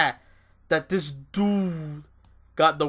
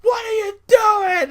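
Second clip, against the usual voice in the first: much higher, wailing and half-laughing, slow; mock horrified Paul Heyman; 3 dB louder, much slower than usual. Imitating Heyman seeing Brock crushed, a high mock wail. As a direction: ham up a sobbing manager watching his guy get hurt. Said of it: "he was like".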